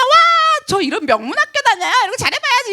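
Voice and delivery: high, excited mock gush of a new student; mock eager student. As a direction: ham up the keen beginner. (들뜬 학생 흉내)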